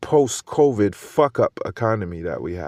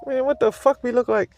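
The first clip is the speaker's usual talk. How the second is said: high-pitched incredulous reply; 13 semitones higher than usual. Retold or imitated. Husband answering wife's sale idea, high incredulous squeak. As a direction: squeak it out in disbelief. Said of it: "I'm like".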